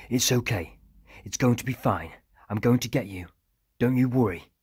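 urgent reassurance. A narrator's reading. Harper to the girl held hostage, urgently reassuring. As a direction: reassure her across the room, pitch lifted.

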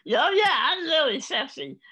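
very high, loud comic strut; hammed-up sexy boast. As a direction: belt it out like a strutting show-off. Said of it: "a seventy-year-old man strutting across the stage saying".

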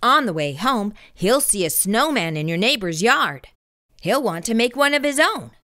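usual speaking voice

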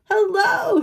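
loud high booming hello. Acted. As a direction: boom it back just as loud. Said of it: "he boomed back".

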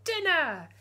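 put-on high, loud baby call; baby demanding. Hungry baby calling for dinner, high and loud. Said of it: "he said".

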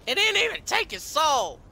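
high loud furious outburst; angry outburst. Real, not acted. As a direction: explode in disbelief. Soul not absorbed.

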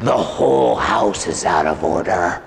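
low, slow, deep demon. Retold or imitated. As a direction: imitate a demon claiming its rights, deep and drawn out. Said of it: "And the demon said".

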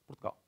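Portugal softly reduced to "Prtgal"; European Portuguese pronunciation. Shown as a demonstration. Teacher repeating the European form, soft "Prtgal" for Portugal. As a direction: drop the unstressed vowels in Portugal. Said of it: "like in Portugal".

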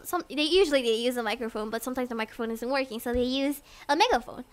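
ordinary talk

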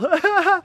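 short high giggle; real high-pitched chuckle; amused laugh. Real, not acted. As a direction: laugh at the dog's antics. Laughing at the dog.

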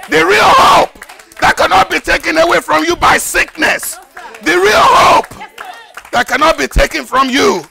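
building to loud, high cries of hope; preacher at full force; climactic preaching. Acted. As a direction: lift the crowd on the real hope.

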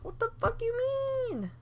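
high-pitched disbelieving what; incredulous. Real, not acted. Player baffled by her Sims, high incredulous. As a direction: squeal it in shocked disbelief.

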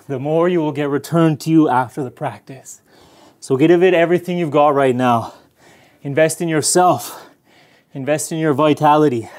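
energetic trainer urging them on; pumped-up coaching. Acted. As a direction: fire up the class to give everything. Coaching during hard practice.

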